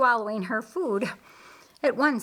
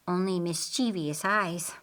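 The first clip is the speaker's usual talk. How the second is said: ordinary delivery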